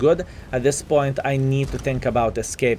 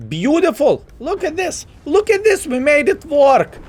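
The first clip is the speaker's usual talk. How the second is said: much higher, thrilled squeal of joy; excited cheer; 4 dB louder than usual. Real, not acted. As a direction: celebrate like you just pulled it off. Tank survives, player celebrates.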